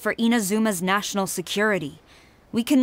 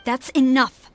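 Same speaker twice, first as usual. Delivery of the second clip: louder, cutting them off; stern rebuke; 4 semitones higher, 5 dB louder, slower than usual. Acted. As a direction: shut the excuses down. Commander cuts off excuses.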